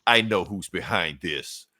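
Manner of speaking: low, slow, grave hero voice; deep dramatic Superman reveal. Retold or imitated. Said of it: "Superman's just like".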